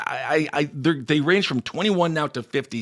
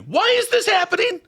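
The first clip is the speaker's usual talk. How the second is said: much higher, hammed-up panic; mock panic. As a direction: squeal it in fake alarm.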